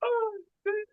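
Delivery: high, squeaky laughter; cracking up. Real, not acted. Laughing at own hoity-toity phrase, high laugh. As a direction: catch your breath after a big laugh.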